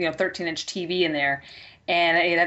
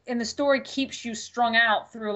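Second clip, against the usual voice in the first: ordinary delivery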